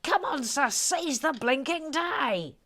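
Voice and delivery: high, drawn-out rallying call; hammed-up urging, stretching the last words; rousing exhortation. Host urges Eric to seize the day, rousing exhortation.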